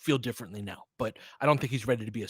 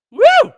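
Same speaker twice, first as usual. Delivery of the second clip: loud, high woo of joy; real loud, high stadium whoop; cheering whoop; 17 dB louder than usual. Real, not acted. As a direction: whoop like your team just scored. Cheering a made three.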